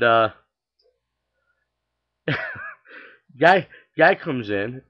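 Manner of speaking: ordinary delivery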